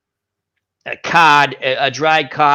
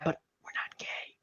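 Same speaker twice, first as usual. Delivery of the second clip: whispered aside; 21 dB quieter than usual. Real, not acted. Aside to the listener.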